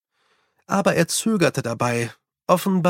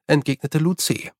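ordinary delivery

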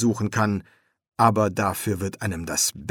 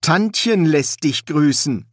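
raised, louder and slowed; speaking loudly; 5 semitones higher, 5 dB louder than usual. Acted. Son passing greetings to a ghost, loud and slow. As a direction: say it up and slow, as to someone hard of hearing. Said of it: "sagte er laut".

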